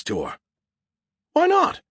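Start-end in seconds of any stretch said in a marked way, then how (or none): 1.3-1.8 very high-pitched squeak of protest; shrill, affronted falsetto outburst; indignant squeal. Acted. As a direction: squeal it like a thwarted show-off. Skippy protests at bad news.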